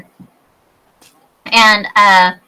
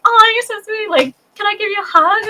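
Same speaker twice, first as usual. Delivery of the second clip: much higher, tender gushing; gushing sweetly; 12 semitones higher than usual. Retold or imitated. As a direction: coo over the sweet kid. Gushing at the girl.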